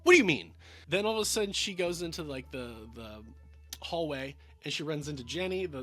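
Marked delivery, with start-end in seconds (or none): 0.0-0.5 loud, raised-pitch incredulous questioning; imitated indignant challenge. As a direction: throw it back at them, mock-affronted. Oliver challenging the maid, retold.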